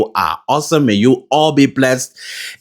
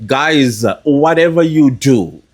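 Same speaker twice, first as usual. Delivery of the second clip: ordinary delivery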